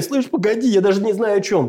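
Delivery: high, cocky gopnik brush-off; street thug voice. Retold or imitated. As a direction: lightly play a street punk dodging. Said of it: "Это страна такая".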